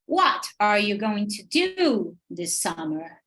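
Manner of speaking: slow, with WHAT, DO and SUMMER stressed; exaggerated sentence stress. Shown as a demonstration. Teacher modeling question rhythm, exaggerated stress on What, do, summer. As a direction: lean hard on "What", "do" and "summer". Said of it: "Eu tô fazendo bem exagerado".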